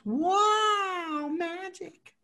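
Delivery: put-on high, wide-eyed wow for the kids; playful put-on wonder, trailing soft; mock amazement. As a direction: pretend to be dazzled by magic. Mock wonder at pen trick.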